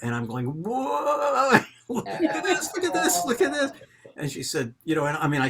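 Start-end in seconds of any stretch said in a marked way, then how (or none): 0.5-3.7 high, laughing squeal of delight; giddy laughing exclamation at a surprise gift; excited squeal. Retold or imitated. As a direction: gush over it, laughing, pitched way up. Retold gasp at dessert tray.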